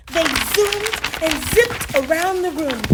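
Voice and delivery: usual speaking voice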